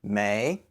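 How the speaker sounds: "May" starting low and rising; rising intonation. Shown as a demonstration. Teacher demonstrating the word's tone, rising tone on "May". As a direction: let "May" climb from a soft, low start. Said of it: "say it with a rising intonation".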